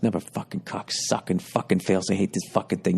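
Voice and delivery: soft grumbled cursing to oneself; imitated muttering. Angry customer grumbling to himself in the store, muttering. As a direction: curse under your breath, keeping it low. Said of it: "I'm muttering to myself".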